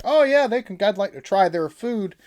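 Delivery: high, bright mock-enthusiastic take; eager American viewer. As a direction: play the keen viewer won over by TV chefs. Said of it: "be like".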